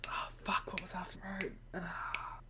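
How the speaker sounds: hushed unvoiced whisper mid-promo; whispered aside. Raven forgets his lines and whispers to himself.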